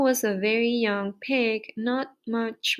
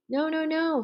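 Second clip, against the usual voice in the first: higher-pitched, lightly voiced goose; urgent warning; 6 semitones higher than usual. A narrator's reading. Goose warns Wilbur off the pail, mildly urgent. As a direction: object quickly, pitch raised.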